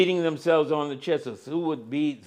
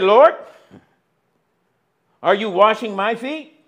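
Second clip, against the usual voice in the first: startled question; 5 semitones higher, 9 dB louder than usual. Acted. Peter questioning Jesus, acted.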